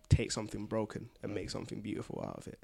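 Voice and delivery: ordinary delivery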